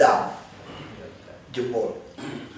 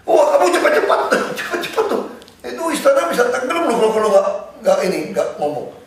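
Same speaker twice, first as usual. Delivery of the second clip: higher, louder, fast pressing urgency; retold urgent warning, rushing him along; imitating an alarmed friend telling him to hurry; 10 semitones higher, 5 dB louder, faster than usual. Bang Yos urging him to hurry.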